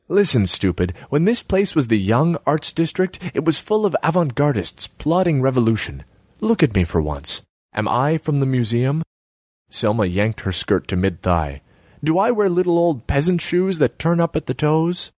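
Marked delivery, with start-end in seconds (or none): 0.1-9.0 higher pitched, nagging artsy woman; Selma's voice. Acted. Selma needles Borislav about his empty kiosk, high voice. 12.0-15.1 higher pitched, nagging artsy woman; Selma's voice. Acted. Selma needles Borislav about his empty kiosk, high voice. Selma mocks peasant style.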